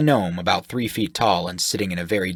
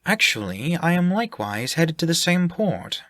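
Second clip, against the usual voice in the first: higher, airy and unsure; vague half-elf voice; 10 semitones higher than usual. Acted. Elwood vaguely explains the job, higher and unsure. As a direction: say it like you barely know why.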